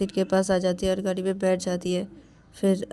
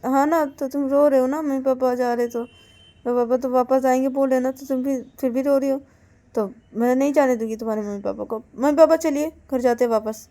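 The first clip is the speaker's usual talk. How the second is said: raised pitch, pressing argument; insistent protest; 5 semitones higher, 5 dB louder than usual. Acted. Partner argues that crying Payal's parents must stay, insistent protest. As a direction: protest on someone else's behalf.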